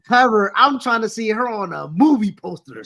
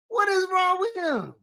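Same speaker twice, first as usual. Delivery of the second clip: mock outrage; 9 semitones higher than usual. Real, not acted. Mock outrage at cohost's take.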